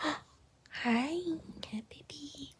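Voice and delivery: tender whisper to a pet; whisper to the dog. Real, not acted. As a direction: hush it to the little dog. Greeting the dog softly.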